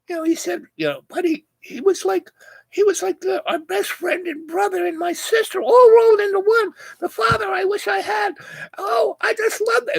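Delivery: high mock girly voice; gushing models. Models gushing to the wife about him, in a high mock squeal.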